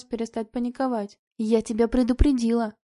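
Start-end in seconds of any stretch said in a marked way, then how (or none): none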